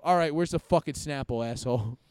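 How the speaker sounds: loud, gruff demand from a retold cop; acted angry cop. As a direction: imitate a cop confronting a suspect, loudly. Retelling cops confronting him.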